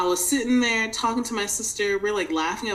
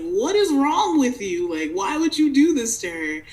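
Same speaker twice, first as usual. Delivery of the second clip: sister yelling at mom, louder and higher; angry yelling; 5 semitones higher, 4 dB louder than usual. Retold or imitated. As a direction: rage at the mother down the phone. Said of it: "started screaming at my mom".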